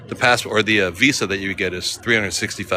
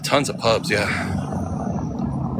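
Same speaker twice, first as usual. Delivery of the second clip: normal talking voice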